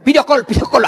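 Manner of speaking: shrill-toned demand as the suspicious wife. Retold or imitated. Wife demanding video call.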